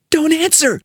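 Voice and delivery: high, tense warning; high-pitched, urgent caution; urgent hiss. A narrator's reading. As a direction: hiss it out in alarm. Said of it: "hissed Duffy".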